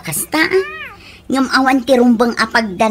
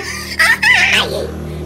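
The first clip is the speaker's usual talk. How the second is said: a sudden very high laugh; high mocking laugh; high burst of laughter. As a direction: squeal with laughter. Laughing at loser.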